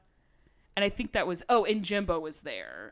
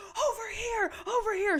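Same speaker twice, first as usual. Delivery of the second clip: screaming; 12 semitones higher than usual. Retold or imitated. Cheering friends call to the queen, screaming. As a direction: yell to get the drag queen's attention.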